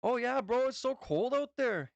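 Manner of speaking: hammed-up North Dakota accent complaining of the cold. Shown as a demonstration. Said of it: "some of us have an accent like me".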